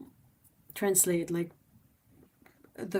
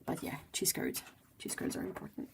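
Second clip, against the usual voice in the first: murmured aside; 12 semitones lower, 10 dB quieter than usual. Real, not acted. Quiet aside.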